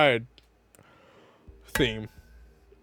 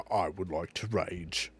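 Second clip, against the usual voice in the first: low mock name; 7 semitones lower, 9 dB quieter, slower than usual. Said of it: "the first one I saw was".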